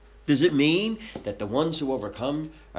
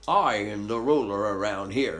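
a tiny braggart full of himself; puffed-up flea; 3 semitones lower than usual. Acted. Flea boasting to the dog, a little pompous voice. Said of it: "and says".

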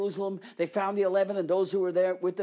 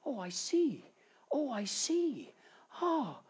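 dawning realization; 9 semitones higher, slower than usual. Acted. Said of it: "and suddenly".

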